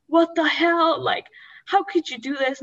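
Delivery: loud, high-pitched imitated outburst; retold outrage. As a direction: act out an outraged brother-in-law confronting someone. Brother-in-law's outrage acted out.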